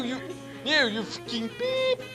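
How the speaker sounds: ordinary delivery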